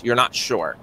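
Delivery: usual speaking voice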